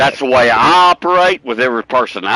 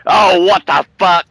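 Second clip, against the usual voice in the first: mock angry outburst; 6 semitones higher, 4 dB louder than usual. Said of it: "get madder than hell".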